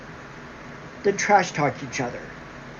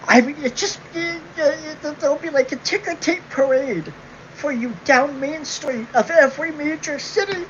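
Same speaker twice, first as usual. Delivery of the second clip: higher mock-amazed sarcasm; pitched-up mocking enthusiasm; sarcastic awe; 10 semitones higher than usual. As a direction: gush with fake wonder about a parade.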